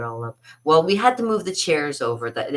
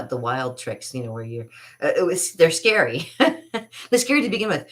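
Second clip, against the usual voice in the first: ordinary talk, nothing marked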